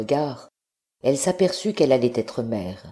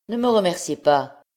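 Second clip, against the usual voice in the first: curt, higher and louder; brusque; 5 semitones higher, 4 dB louder than usual. A narrator's reading. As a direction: cut her thanks off sharply. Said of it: "répondit Fine avec brusquerie".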